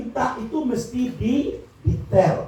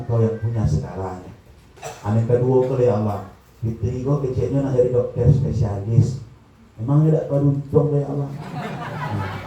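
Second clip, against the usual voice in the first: acted detailed prayer; 8 semitones lower than usual. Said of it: "gitu doa itu cek-cek".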